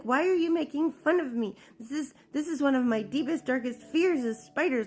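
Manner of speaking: high-pitched indignant protest; retold anger. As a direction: re-enact a hurt, angry demand. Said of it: "I got really, really angry".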